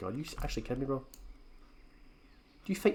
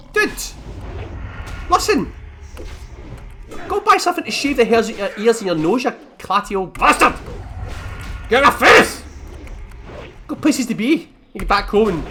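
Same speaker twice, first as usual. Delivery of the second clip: louder mock rant at an old man; imagined rant; 3 semitones higher, 4 dB louder than usual. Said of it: "I didn't say that, obviously".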